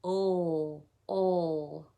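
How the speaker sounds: "Oh" as a held L sound, "ull"; letter-sound drill. Shown as a demonstration. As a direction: hold the letter L's sound on each "Oh". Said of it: "Lizzie Lizard says".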